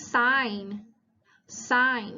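"sign" said as sine, no g sound; silent G. Shown as a demonstration. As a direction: model "sign" with the G left silent. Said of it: "ตัว G ไม่ออกเสียงนะคะ".

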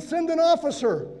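a soldier's request, called out; calling out. Acted. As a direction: call across to the other side. Enemy soldiers calling across.

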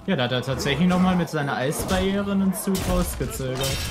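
normal talking voice

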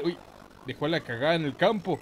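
ordinary talk

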